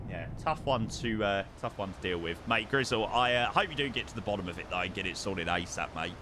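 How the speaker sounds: normal talking voice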